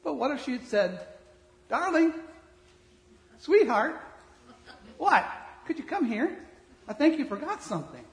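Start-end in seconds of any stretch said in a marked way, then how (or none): 1.7-8.0 sweet calling wife. Acted. Acted wife sweetly calling husband.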